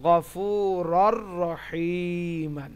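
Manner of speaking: "গফুরর রহিমান" very slow with a doubled r; merged recitation. Shown as a demonstration. Teacher reciting the joined word for students, drawn-out recitation. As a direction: stretch the long vowels, join "গফুরর" straight into "রহিমান". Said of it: "আসলে কি আছে উচ্চারণ".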